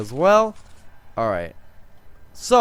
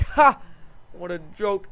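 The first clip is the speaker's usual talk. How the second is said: mocking laugh; 5 semitones higher than usual. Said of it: "he's looking at them greens like".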